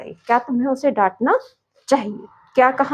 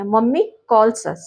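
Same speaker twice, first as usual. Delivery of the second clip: slow dictation; much slower than usual. Shown as a demonstration. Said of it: "mummy हमें बुलाती हैं".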